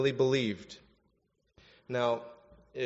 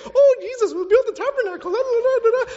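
very high, loud mocking voice; Peter as oblivious babbler; 10 dB louder, faster than usual. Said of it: "he's just not getting it".

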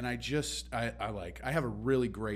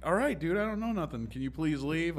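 higher, fed-up brush-off; a hammed-up sarcastic customer-service voice; exasperated; 6 semitones higher than usual. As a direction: sigh them out the door, mock weary. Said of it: "I'm like".

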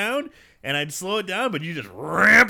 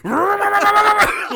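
laughing shout; 14 semitones higher, 8 dB louder than usual. Real, not acted. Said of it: "laughing outburst".

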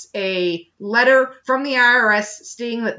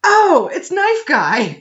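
high, loud, drawn-out exclamation; mock loud recognition; 8 semitones higher, 7 dB louder, slower than usual. Hunter recognizing the knife guy, hammed-up loud exclamation. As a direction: call it out in exaggerated recognition.

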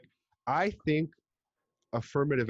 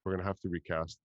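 low, subdued, slightly softer aside; mock casting boss; 6 semitones lower, 5 dB quieter than usual. Retelling what somebody says in meetings, in a low mock voice. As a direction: deliver it as a lowered-voice verdict. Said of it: "somebody always goes".

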